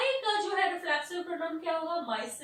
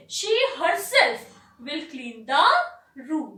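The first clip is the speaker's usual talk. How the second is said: "she herself" said louder and firm; emphatic stress; 8 dB louder, much slower than usual. Shown as a demonstration. Teacher giving a second emphatic example, louder stress on "she herself". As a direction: punch "she herself" then carry on. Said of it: "stress out कर रहे हैं".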